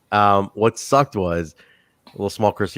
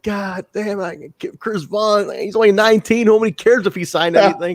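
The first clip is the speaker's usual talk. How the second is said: mock frustrated rant; 10 semitones higher than usual. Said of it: "all frustrated".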